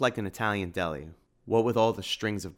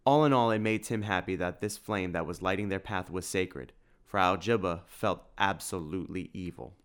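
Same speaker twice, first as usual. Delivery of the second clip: usual speaking voice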